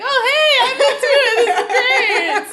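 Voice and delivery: high, loud, laughing delighted voice; gushing fan voice, laughing through it; mock giddy Xena. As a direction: squeal it out like she's suddenly thrilled. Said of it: "And she's like".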